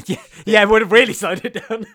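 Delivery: laughing through the words; chuckling while talking. Real, not acted.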